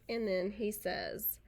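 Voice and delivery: plain reading voice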